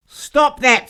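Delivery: high, loud furious cry; a husband shouting at his kissing wife, furious; angry shout. Acted. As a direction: yell it at them. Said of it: "said Mister Edison".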